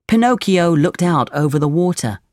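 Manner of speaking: ordinary delivery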